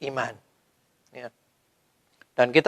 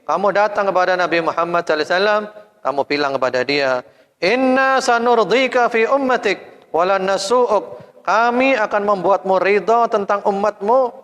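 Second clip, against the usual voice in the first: solemn divine proclamation; 7 semitones higher than usual. Acted. God sending Jibril with good news, high and solemn. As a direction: proclaim the promise grandly. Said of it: "Lalu kata Allah".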